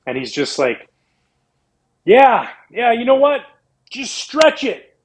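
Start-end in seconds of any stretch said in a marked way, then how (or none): 2.0-5.0 high, loud call drawn out slow on the end; yelled from across the range. Retold or imitated. Teammate yelling across the range to keep swinging. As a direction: holler it across the driving range. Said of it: "he yells at me across the range".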